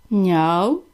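small kitten meow, voiced low; a gentle kitten's meow. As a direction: answer like a kitten. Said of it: "відповіло кошеня".

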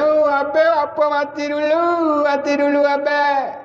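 stretched, high-pitched imitation of a grieving wife; mock wailing. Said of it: "Teriak gitu nah".

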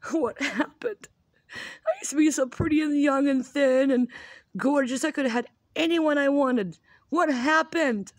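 high, hammed-up self-pitying wail with a laughing cry; mock sob. Fifty-year-old mom mock-wailing about her lost looks, hammed-up sob. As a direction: mourn your lost looks in over-the-top mock sorrow.

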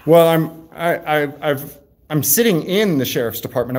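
ordinary talk, nothing marked

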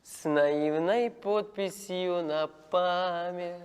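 very slow, even delivery with long pauses; drawn-out recital. Acted. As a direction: recite it dreamily, word by word. Slowly writing or reciting an inscription. (повільно, розтягуючи слова)

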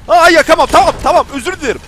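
high, loud mock surrender; scared backing down; panicked apology. Real, not acted. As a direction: plead with the robot. Backing down from the enemy.

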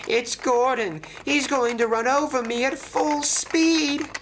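very high-pitched terror, acted out; panicked cry. A narrator's reading. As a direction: cry out as the engine bears down. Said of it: "he cried".

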